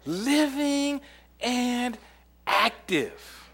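very slow, high, drawn-out proclamation; slow emphasis. Shown as a demonstration. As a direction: stretch each word like a proclamation. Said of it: "drawn out scripture phrase".

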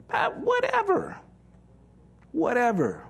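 high, sing-song brush-off; mock dismissive. Preacher mocks believers who shrug off God's wisdom in a high brush-off. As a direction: toss it off like a bored teen.

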